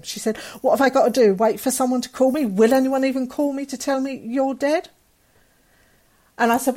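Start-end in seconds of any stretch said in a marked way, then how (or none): 0.6-4.9 higher, indignant retold outburst; imitating an exasperated rant, pitch climbing; retold losing it. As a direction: demand answers as a cousin at breaking point. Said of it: "lost it".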